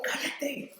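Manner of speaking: high, hushed mock scolding; crowd shushing a beggar. Everyone telling the blind man to hush, high muted scolding. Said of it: "todos le decían al ciego".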